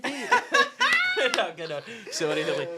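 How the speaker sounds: high, loud burst of laughter; collapsing into giggles, apologising; real laughter. Real, not acted. Embarrassed by his own accent try, laughing loudly.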